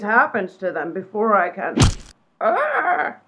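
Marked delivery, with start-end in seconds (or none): 2.4-3.2 high sharp cry of pain; sudden shriek. Acted. Psychic struck by a falling rock, sharp high shriek. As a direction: yelp as the rock hits. Rock falls on her head.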